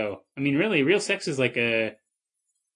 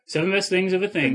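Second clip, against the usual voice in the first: higher, announced like a show segment; segment title announcer; 7 semitones higher than usual. Shown as a demonstration. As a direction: present the title like a host. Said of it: "So here we are".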